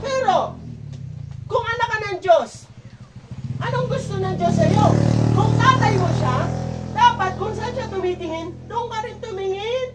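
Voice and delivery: loud, high-pitched preaching push; forceful preaching. Acted. Pastor pressing his congregation on being God's children, preaching loud. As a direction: drive it home to the congregation.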